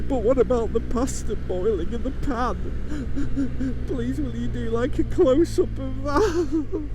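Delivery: very high, slow, whimpering plea; whiny begging viewer. Retold or imitated. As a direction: beg like a whining child. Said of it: "You're like".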